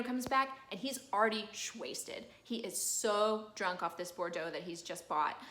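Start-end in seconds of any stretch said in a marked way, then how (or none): none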